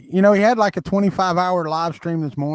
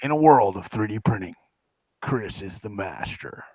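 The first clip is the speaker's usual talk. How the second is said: movie trailer voice; 9 semitones lower, 6 dB quieter than usual. Retold or imitated. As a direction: intone it like an epic trailer. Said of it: "the guy that does the".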